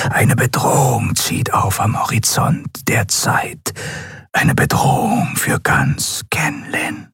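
half-whispered warning of doom; eerie oracle. Acted. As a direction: intone it like a seer in a trance. A figure speaks in eerie prophecy.

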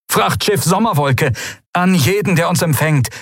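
high, urgent radio hail; high-pitched emergency broadcast; distress caller. A narrator's reading. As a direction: call high to anyone who might be listening. Stricken captain's broken-up distress call.

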